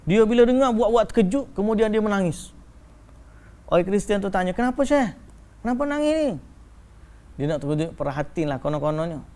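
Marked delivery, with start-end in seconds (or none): 4.5-6.3 concerned questioner. Retold or imitated.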